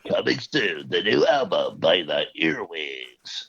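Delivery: slow, low movie-trailer announcer; mock trailer voice. Announcing a fake album release in trailer voice.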